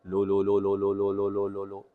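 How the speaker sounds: लो dropped low and soft; low pitch drill. Shown as a demonstration. Coach demonstrates the low-pitch drill for students. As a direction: sink each लो deep and quiet. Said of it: "उसी pitch पे, उसी tone के साथ बोलना है".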